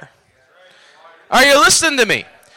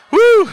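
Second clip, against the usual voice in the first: short high "woo"; high whoop; 10 semitones higher, 4 dB louder than usual. Real, not acted. Preacher praying over someone, high whoop. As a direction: let out a revival whoop.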